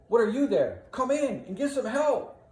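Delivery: high, loud summons; calling for help. Acted. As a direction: shout over to distant bystanders. Iago calls bystanders over to help.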